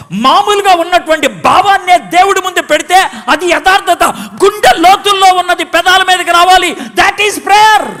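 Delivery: preaching at full force to the congregation; fiery preaching. Acted. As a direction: hammer home the crowd of millions. Preaching climax.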